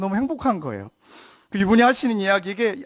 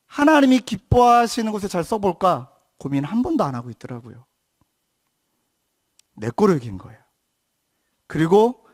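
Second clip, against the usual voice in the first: normal talking voice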